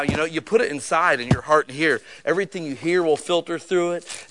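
ordinary delivery

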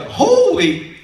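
high, loud exclamation of amazement; astonished outburst. Retold or imitated. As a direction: blurt it out in shock, imitating her. Lady's reaction to gifted computer.

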